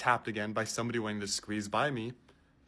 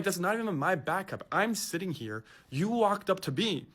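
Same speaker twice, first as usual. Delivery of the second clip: higher-pitched defensive protest; 6 semitones higher than usual. Retold or imitated. Passenger denying the lobster backpack, higher-pitched protest. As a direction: push back, shocked and defensive.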